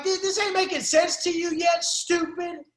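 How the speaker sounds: scolding the fake prison gangsters; scolding retort. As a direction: talk down to them. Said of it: "I'm like".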